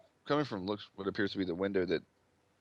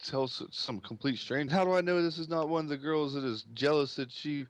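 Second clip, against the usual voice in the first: higher, hurried protesting dad; defensive, suspicious father; 6 semitones higher than usual. Acted. Father doubting a stranger's motives, agitated and defensive.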